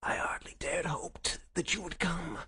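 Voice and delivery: high, breath-thin plaintive whisper; weak whisper. Acted. Sick Holmes thanks visitor for coming, weak whisper. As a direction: whisper as if near death. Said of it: "Holmes whispered".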